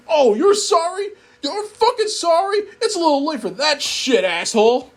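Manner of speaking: high, mocking furious voice; sarcastic anger. Jack throwing the narrator's apology back at him, high sneering fury. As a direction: sneer back his sorry.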